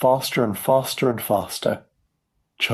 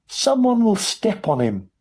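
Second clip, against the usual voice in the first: high-pitched worried wail; dismayed cry; 12 semitones higher than usual. A narrator's reading. Mother fearing for her tiny son, dismayed cry. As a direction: fret in a high voice. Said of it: "cried Missus T V".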